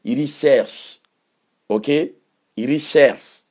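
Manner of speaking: "Recherche" as iri-shersh, drilled; teaching pronunciation. Shown as a demonstration. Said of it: "on dit recherche".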